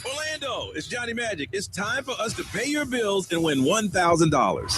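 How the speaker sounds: upbeat radio DJ promo. Acted. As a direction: hype it like a radio jingle. Radio host promo read.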